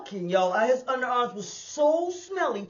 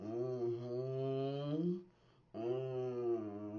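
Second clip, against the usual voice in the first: much lower, softer guttural moan; imitated moan; 11 semitones lower, 15 dB quieter than usual. Mimicking the smelly man's moan to the audience. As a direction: drag out a deep lustful groan. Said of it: "He was like".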